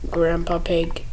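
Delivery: ordinary talk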